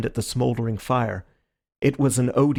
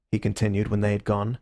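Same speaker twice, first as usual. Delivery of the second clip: plain reading voice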